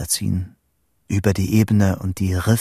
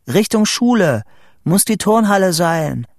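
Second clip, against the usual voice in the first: high, raised excited report; excited calling; 9 semitones higher, 4 dB louder than usual. A narrator's reading. Boy telling Vito where lightning hit, excited calling. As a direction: call out where it struck.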